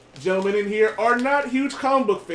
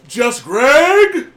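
loud high introduction; announcer shout; 6 semitones higher, 10 dB louder than usual. Acted. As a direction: call out the name. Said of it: "I am your host".

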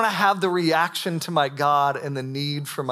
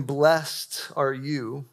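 drawn out; much slower than usual. Shown as a demonstration. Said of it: "Jesus is saying".

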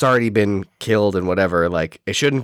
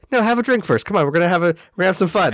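lightly voiced jovial dad, a bit higher; dad at a wedding; 7 semitones higher, much faster than usual. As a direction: coax him to drink like a cheery uncle. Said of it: "very dad at a wedding energy".

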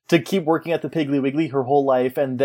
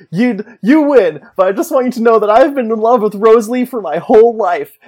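a rival's overwrought love speech to the winner, higher and louder; mock dramatic love confession; 7 semitones higher, 5 dB louder than usual. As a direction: ham up the heartbroken rival's speech, pitched high. Pete's dramatic confession retold.

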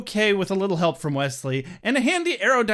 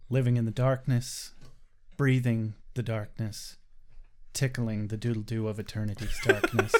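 put-on brooding teen voice; 8 semitones lower, 6 dB quieter than usual.

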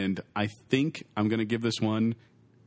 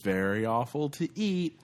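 ordinary delivery

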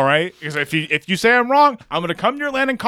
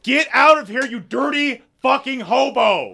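mock angry yell; 8 semitones higher than usual. Playing Roman shouting at Jesus.

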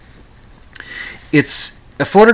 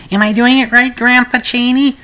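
put-on little girl voice; 11 semitones higher, 3 dB louder than usual.

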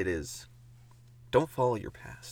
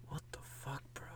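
whispered; 17 dB quieter than usual. Acted. Shocked reaction whispered in story.

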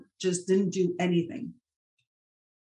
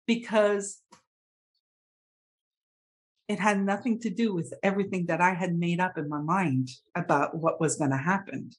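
ordinary talk, nothing marked

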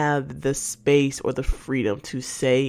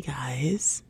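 whispered; 2 semitones lower, 8 dB quieter than usual. Real, not acted. Whispered aside.

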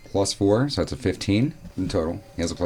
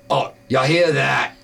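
monster voice; 6 semitones higher, 4 dB louder, slower than usual. Acted. Giant hears the rock.

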